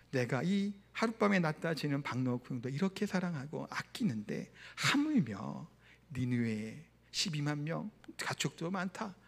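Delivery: low, soft, slowing voice of God; tender reasoning. Retold or imitated. God answers Jonah about pitying Nineveh, tender and grave.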